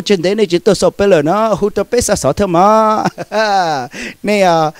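usual speaking voice

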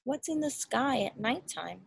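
ordinary delivery, nothing marked

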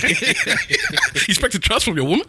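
high, slow, laughing incredulity; laughing disbelief. Real, not acted. Man challenges friends on trust, high laughing disbelief.

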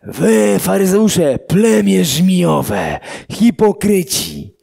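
loud, slow, drawn-out denunciation; angry rebuke. Acted. Preacher voicing Jesus at the Pharisees, slow loud rebuke. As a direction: thunder it at the hypocrites.

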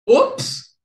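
high, loud startled exclamation; loud, high surprised oops. Acted. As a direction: yelp it like a little mishap. Octopus mishap exclaimed.

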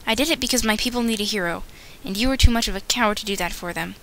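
rushed, fast defiant retort; Mara, fast. Acted. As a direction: rattle it off fast in self-defence. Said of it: "Now for Mara".

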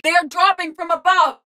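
high-pitched shouted warning; urgent combat callout. Acted. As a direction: call out a threat to the squad. Warning squad under attack.

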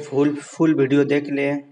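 ordinary talk, nothing marked

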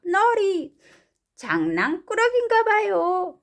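put-on high-pitched child voice; story baby. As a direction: speak as a little child tattling. Baby's line and translation.

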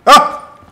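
short, high, loud yelp; startled yelp. Real, not acted. Player thinking an enemy is there, startled yelp. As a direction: jump with fright.

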